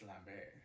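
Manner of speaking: Lambert as "lam-BAIR", silent T; no-T pronunciation. Shown as a demonstration. Insisting on the actor's name, Lambert without a T. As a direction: leave the T off Lambert, French-style. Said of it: "With a T or no T?".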